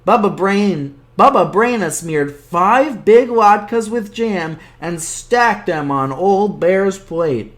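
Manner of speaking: plain reading voice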